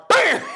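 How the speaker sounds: very high, sudden bam; imitated gunshot. Man reading numbers gets shot, imitated gunshot. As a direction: cut in with a mock gunshot, laughing. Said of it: "I mean, he's going".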